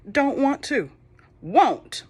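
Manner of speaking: high, loud sulky refusal; stubborn Pharaoh. Acted. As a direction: pout like a spoiled king.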